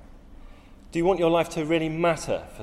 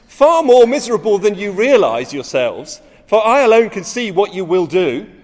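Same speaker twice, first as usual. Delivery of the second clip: louder and higher, a fiery pulpit reading; impassioned preaching; 5 semitones higher, 7 dB louder than usual. Acted. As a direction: preach it as if Thielicke stood before you. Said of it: "this is what Thielicke says about".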